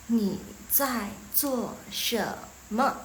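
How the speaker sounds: very slow, syllable by syllable; slow teaching model. Shown as a demonstration. Teacher modelling a question for students, slowly. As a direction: space out each word for learners.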